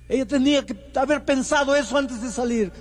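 resentful husband snapping back; husband's angry reply. Retold or imitated. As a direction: cut him off with bitter scorn. Husband voiced angrily in story.

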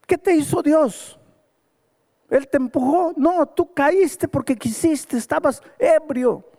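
high, forceful preacher scolding; stern preaching rebuke. Retold or imitated. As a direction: hammer it home like a pulpit rebuke. Pastor rebukes the injured man. (reprimenda de predicador, aguda y enérgica)

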